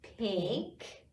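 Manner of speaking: slow, drawn-out naming, each word its own; teacher naming colors with toddlers, slow and clear; naming colors with children. Shown as a demonstration. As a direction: say each color for the kids to repeat. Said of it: "help me say the colors".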